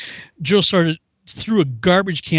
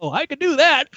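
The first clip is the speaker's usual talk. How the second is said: high, light put-on voice; relieved recruit; 10 semitones higher than usual. Recruit finally understands sergeant, mock relief. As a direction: chirp it like a private who finally understands.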